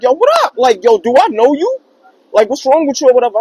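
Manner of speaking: loud, fast, heated challenge; angry confrontation. Retold or imitated. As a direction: confront him loudly. Confronting a stranger who grabbed him.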